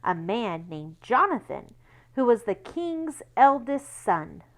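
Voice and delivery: plain reading voice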